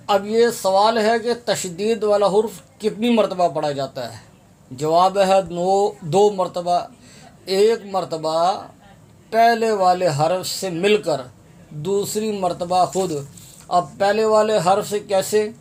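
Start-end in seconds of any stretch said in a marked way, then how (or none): none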